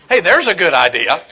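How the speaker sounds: sarcastic. Retold or imitated. Sarcastic punchline in story.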